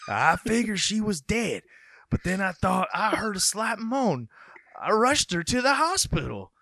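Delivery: put-on high, folksy rural accent. Folksy accent for the man who found her. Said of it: "I don't know if accents are like that in Minnesota".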